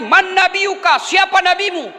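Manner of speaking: high, emphatic angelic questioning; interrogating angel. Acted. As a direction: demand an answer like the questioning angel. Angel asks the next question.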